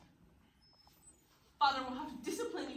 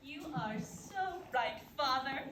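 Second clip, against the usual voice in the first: ordinary talk, nothing marked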